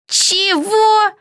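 high, startled "what?"; shocked cry. A narrator's reading. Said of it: "крикнула Зельда". (изумлённый выкрик высоким голосом)

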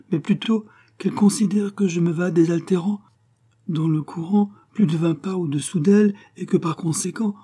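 high-pitched meek lamb voice; timid, gentle lamb. A narrator's reading. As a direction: plead gently as a little lamb. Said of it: "d'un air timide et doux, en vrai agneau".

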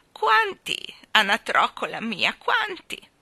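put-on loud, slow, quavering old balloon seller; trembling old woman. Balloon woman chides the children, in a loud trembling old voice. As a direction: scold them like a frail old woman full of passion.

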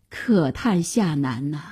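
ordinary delivery

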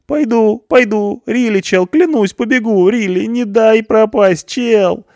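very high, loud eager cry; hopeful shout. A narrator's reading. Punk eagerly promises to reform, loud high cry. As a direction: grab at the lifeline. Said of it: "закричал с надеждой Панк".

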